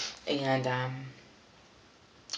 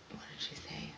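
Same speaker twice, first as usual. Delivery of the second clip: whispered aside; 16 dB quieter than usual. Real, not acted. Aside while recalling the quote.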